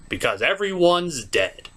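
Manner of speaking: ordinary talk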